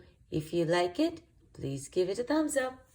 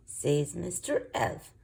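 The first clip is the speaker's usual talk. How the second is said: ordinary delivery, nothing marked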